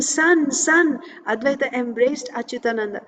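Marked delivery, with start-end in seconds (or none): 0.0-1.0 high, imitated cry of joy; blissful exclamation. Father embracing his son, retold blissful exclamation. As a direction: call out as if overjoyed. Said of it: "became filled with bliss".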